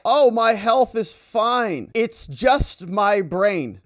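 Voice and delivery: very slow, loud and high, stretching every word; hammed-up dim old president; drawn-out mock Biden. Biden answers the governor, loud, high and very slow. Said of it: "Mister Biden said".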